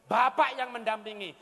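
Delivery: seruan keras kepada para bapak; tegas keras. Real, not acted. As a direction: tekankan dengan suara lantang. Menegur para bapak dengan keras. (seruan lantang kepada bapak-bapak)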